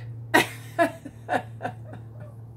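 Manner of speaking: airy unvoiced giggle; breathy laugh. Real, not acted. Laughs at own joke.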